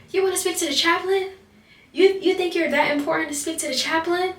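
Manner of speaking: high, incredulous questioning; scornful disbelief. Retold or imitated. Trainer to trainee asking for chaplain, high mock disbelief.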